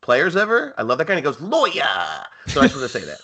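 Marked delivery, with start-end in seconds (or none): 1.4-1.9 put-on Boston accent, drawn-out Lawyer. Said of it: "my only Boston accent".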